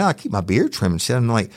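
ordinary talk, nothing marked

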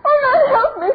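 high, loud tearful cry for help; a wife who can't hold her fainting husband, crying. Acted. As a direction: sob it out, desperate.

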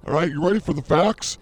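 usual speaking voice

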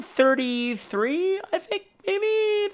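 very high, soft, drawn-out squeak; unsure guess. Real, not acted. Host guessing the episode number, high and tentative. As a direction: let the doubt climb into a slow falsetto.

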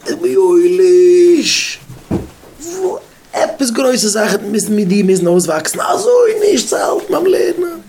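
put-on high, loud yelling rant at a young student; retold yelling. Teacher yelling at his student, loud high shouting. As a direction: shout it out the way the teacher once yelled. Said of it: "האט מיך איינמאל אזוי אנגעשריגן".

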